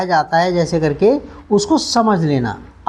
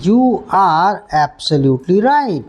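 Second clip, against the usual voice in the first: slow model sentence; much slower than usual. Shown as a demonstration. Teacher modelling a sentence slowly for the class. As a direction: say it slowly, weight on right. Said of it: "ऐसे कह सकते हैं".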